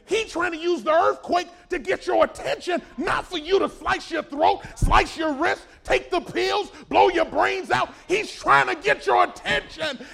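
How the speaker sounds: loud, rising preacher's call; full-force preaching. Acted. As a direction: drive it home to the congregation.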